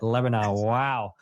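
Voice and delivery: ordinary talk, nothing marked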